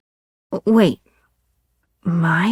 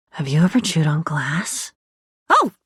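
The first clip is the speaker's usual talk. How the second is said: usual speaking voice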